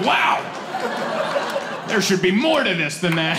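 high, laughing mock wow; fake-impressed coach; mock amazed. New coach mock-amazed at easy signup, high and laughing. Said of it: "And I'm like".

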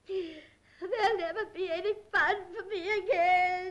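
self-pitying, weepy lament; crying. Acted. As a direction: wail it hopelessly through tears. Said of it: "why you're crying".

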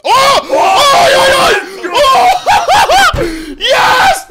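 high, loud screams of joy with laughter; ecstatic shouting. Real, not acted. Streamer screaming at a rare case drop, ecstatic shouts. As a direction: lose it over the drop. Big win on case opening.

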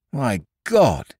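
high startled exclamation; cried out. A narrator's reading. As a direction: blurt it out in shock. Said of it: "cried the atheistic cobbler".

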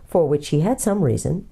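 plain reading voice

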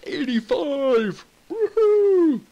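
high, drawn-out score call into a whoop; excited cheer. Real, not acted. Player celebrates a score, excited high cheer. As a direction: whoop it up, rising high and loud.

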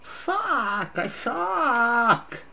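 high, drawn-out self-pitying whine; stretched high cry of frustration; frustrated wail. Real, not acted. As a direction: wail it at your own failure. Fails to spell the answer.

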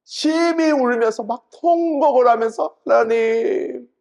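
high, drawn-out wailing plea; wailing cry. Retold or imitated. Preacher acting Hezekiah weeping to God, wailing. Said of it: "심히 통곡하니".

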